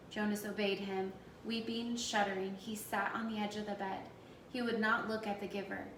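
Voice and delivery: ordinary delivery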